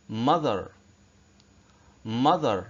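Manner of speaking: mother with the th said like زال; th as voiced z drill. Shown as a demonstration. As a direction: model mother slowly, voiced th. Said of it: "اتنطق زال". (mother بصوت زال)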